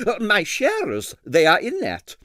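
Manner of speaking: put-on high, heavily accented excitable impresario; Stroganoff's Russian accent. A narrator's reading. Ballet impresario to the detective, in a Russian accent.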